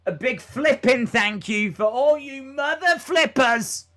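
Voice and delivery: hammed-up Italian accent. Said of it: "Italian impression then".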